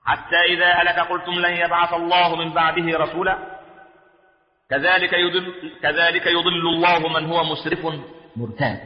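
sustained, even chanting of scripture; Quranic recitation. Acted. Preacher reciting the verse to the congregation, measured chant.

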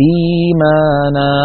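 إيمانا with the إي stretched long and sung; long madd on the first syllable. Shown as a demonstration. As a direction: chant إيمانا slowly, drawing out إي. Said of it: "هذا المد في الياء هو مد بدل". (مدّ مُطوَّل في إيمانا)

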